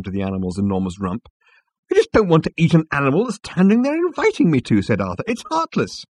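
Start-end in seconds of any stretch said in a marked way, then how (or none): 1.9-4.8 high, loud indignation; worked-up, high-pitched complaint; appalled protest. Acted. Arthur objects, appalled, at table. 5.3-6.1 high-pitched indignation; pained, high objection; appalled protest. Acted. Arthur objects, appalled, at table.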